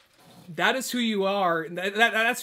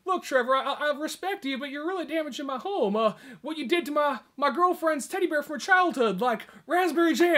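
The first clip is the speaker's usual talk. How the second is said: higher, meek pleading; timid cousin complaining; 8 semitones higher than usual. Retold or imitated. Cousin scolding Trevor over the teddy bear, higher meek pleading. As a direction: complain nervously without daring to push. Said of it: "He says, like".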